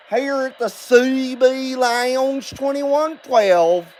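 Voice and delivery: put-on Southern accent, slow and sing-song. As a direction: play a folksy Southern good old boy. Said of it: "I was gonna do my show in an accent".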